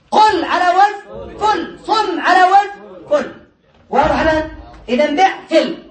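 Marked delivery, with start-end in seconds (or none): 0.1-3.3 قل and صم على وزن فل, slow and higher; teacher chanting the pattern, slow and high; slow emphatic drill. Shown as a demonstration. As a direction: chant each pattern slowly for students. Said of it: "لماذا قلنا على وزن فل".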